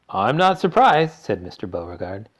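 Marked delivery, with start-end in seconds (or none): 0.1-1.1 slow, loud, higher retort; exasperated. A narrator's reading. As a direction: unsympathetic father, drawing it out. Father retorts to sick daughter.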